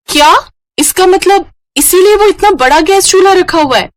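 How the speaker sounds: new bride shocked at the ten-dish rule. Acted. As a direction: gasp out the realisation.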